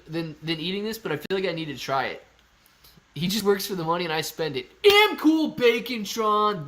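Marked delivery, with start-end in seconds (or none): 4.8-6.6 slow, high, loud announcer drawl. Pretend. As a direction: boom the name out like a host. Reads username in put-on voice.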